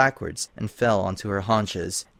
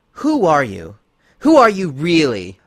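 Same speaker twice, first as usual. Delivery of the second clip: shocked demand; 5 semitones higher, 7 dB louder than usual. Acted. Twilight demanding after shock.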